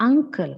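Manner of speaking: "uncle" with NG then a clear K; correct UNCLE. Shown as a demonstration. Teacher models correct pronunciation, K in "uncle". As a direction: say "uncle" as ung-kul. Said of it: "The correct pronunciation is".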